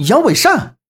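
high, startled question; shocked. Acted. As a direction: gasp out the name in shock. Crowd shocked at name.

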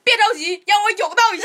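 high called-out reassurance; calling out. Retold or imitated. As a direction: shout it across the room, high. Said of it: "王哥那边喊说". (高声喊话)

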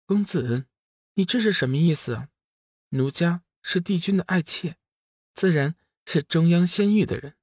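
high, sweetly innocent female character voice; coy fairy. A narrator's reading. Fairy claiming to be the emperor's concubine, high and coy. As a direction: play coy in a lifted, lilting voice. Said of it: "抿嘴冲龙宇笑道".

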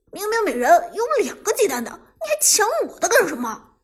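aggrieved scolding, voice jumping up; indignant tattling. Acted. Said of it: "刘思雨立即告状道".

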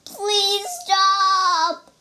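high, loud, stretched-out wail; shouted plea. A narrator's reading. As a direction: beg at full voice for it to end. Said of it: "shouted the bear".

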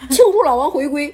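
loud, beaming announcement; proud member. Retold or imitated. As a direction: declare it like a celebration. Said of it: "我说“你干啥呀". (大声自豪地宣布)

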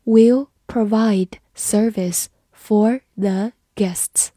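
slow, careful reading for learners. Shown as a demonstration. Teacher reads slowly for the class. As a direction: read it slowly, word by word. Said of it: "好，慢读一遍。".

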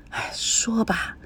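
slow hushed whisper. Acted. Grandmother answering grandfather about the secret, whispered. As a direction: keep it secret, barely voiced.

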